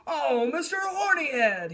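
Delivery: loud call to the rhino. A narrator's reading. Pinned lion calling the rhino, loud call. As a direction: hail him from the ground. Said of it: "he called".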